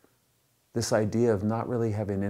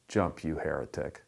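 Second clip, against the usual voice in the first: low, understated dismissal; deadpan condemnation; 4 semitones lower, 4 dB quieter than usual. Acted. Rescuer rejects the conservative on the ledge, deadpan condemnation. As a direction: drop the pitch for a dry punchline. Punchline, rescuer turns on man.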